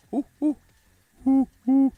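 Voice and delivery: high repeated two-note hoots; owl hoot attempt. Shown as a demonstration. Streamer searching for the owl sound, repeated high hooting. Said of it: "How does an owl sound?".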